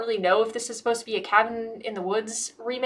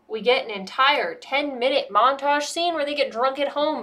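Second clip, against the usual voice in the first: ordinary talk, nothing marked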